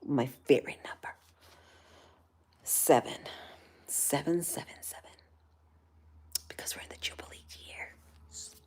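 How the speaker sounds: soft unvoiced whisper. Real, not acted. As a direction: whisper it as a secret. Whispering aside.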